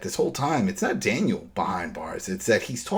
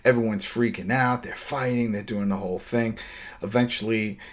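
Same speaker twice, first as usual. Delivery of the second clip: usual speaking voice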